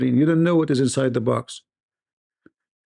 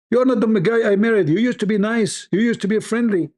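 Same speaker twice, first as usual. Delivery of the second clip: lightly voiced wife, pitched higher, whiny complaint; playing the disappointed wife, a touch higher; imitated nagging wife; 8 semitones higher, faster than usual. Wife to husband, mock complaint, higher voice.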